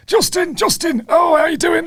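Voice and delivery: put-on very high, loud Yorkshire accent. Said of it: "I can only really do a Yorkshire accent".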